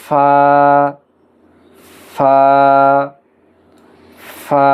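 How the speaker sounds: ফা with its long vowel held evenly; drawn-out recitation. Shown as a demonstration. Teacher leading learners in reading the letter, ফা held long. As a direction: say ফা as a stretched, measured letter name. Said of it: "আমরা পড়ি".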